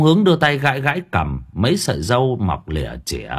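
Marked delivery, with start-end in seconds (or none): none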